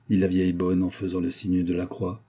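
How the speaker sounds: ordinary delivery, nothing marked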